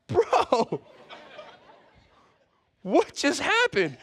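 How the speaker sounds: high, slow, laughing mock bewilderment; a dazed guy who just lost his ear; laughing as a baffled Malchus; Malchus, stunned. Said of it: "Malchus goes".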